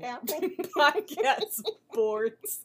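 slow, loud, laughing correction. Real, not acted. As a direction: crack up while correcting yourself. Laughs at own wrong term.

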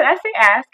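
"ask" with s before k; ask said correctly. Retold or imitated. Woman defending her speech, "ask" with s before k. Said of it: "And I said".

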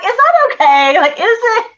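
high, loud laughing question; giggling self-doubt, high and loud. Real, not acted. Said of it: "laughing at herself".

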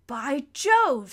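loud, hearty exclamation; delighted amazement. A narrator's reading. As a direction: exclaim at a wonderful sight. Peter amazed at the sea.

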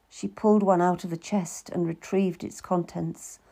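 ordinary delivery

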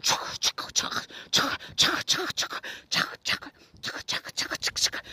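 soft breathy chugga-chugga splashing sounds; imitated splashing noise. Mimicking mom splashing toward her, soft chugging noise. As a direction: mimic frantic swimming strokes with your voice. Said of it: "Y'all should see me. I'm actually sitting here mimicking the motion".

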